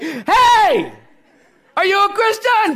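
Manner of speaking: mock yelling. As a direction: call out across the street while laughing. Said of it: "So I started yelling at people across the street".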